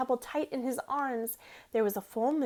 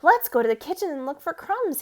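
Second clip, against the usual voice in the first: eager; 4 semitones higher, 8 dB louder than usual. A narrator's reading. Alexander invites his friend.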